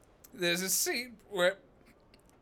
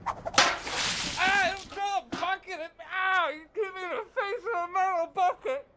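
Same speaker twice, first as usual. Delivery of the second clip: mock pained yelps; 12 semitones higher than usual. Bucket thrown in his face.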